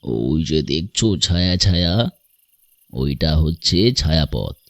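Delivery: deep, unhurried voice of wonder; mysterious stranger. Acted. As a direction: point out the stars in a low voice. (নিচু গলায় রহস্যময় বর্ণনা)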